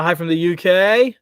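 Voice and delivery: put-on a quick, offhand Australian accent greeting. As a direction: toss out the hello in a light Australian accent, rising at the end. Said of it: "I don't know why I said that in Australian accent".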